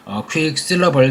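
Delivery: ordinary talk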